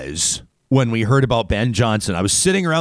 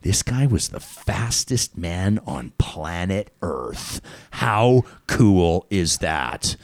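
awed inner voice; 4 semitones lower, much slower than usual. Retold or imitated. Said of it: "I'm like".

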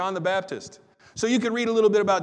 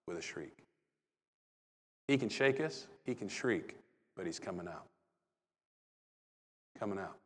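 much softer and lower, almost under the breath; hushed; 9 semitones lower, 12 dB quieter than usual. Real, not acted. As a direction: draw listeners in with a near hush. Hushed reflection after the exorcism.

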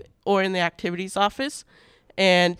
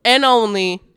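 big announcer reveal; 5 dB louder than usual. Acted. Announces the surprise award.

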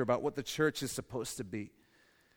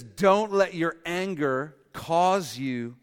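slow, louder, each word pressed; slow emphatic command; 3 semitones higher, 6 dB louder, much slower than usual. Acted. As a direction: lay it down slowly and firmly. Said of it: "But he says".

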